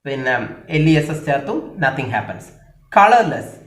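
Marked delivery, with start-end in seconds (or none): 2.9-3.5 colourless with a loud, high first syllable; first-syllable stress. Shown as a demonstration. Teacher modelling stress, first syllable of colourless punched. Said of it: "first syllable ആണ് stress".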